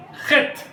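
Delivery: airy خط; letter name Khet. Shown as a demonstration. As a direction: push air through خط. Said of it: "اور خط کے بعد ہے".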